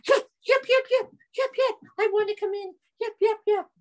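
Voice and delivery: high, loud yapping yeps; eager dog voice. Acted. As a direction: yap happily like a dog.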